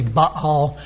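"Baal" in two syllables, "bah-AHL"; Hebrew pronunciation. Shown as a demonstration. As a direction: pronounce "Baal" as "Ba-al", not "bale". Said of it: "So I'm not gonna say".

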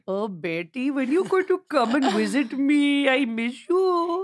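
put-on high, drawn-out accented dad voice; friend's dad impression. Dad begging his daughter to visit, accented dad voice. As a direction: do the pining dad, stretching the ending. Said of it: "Do your dad again".

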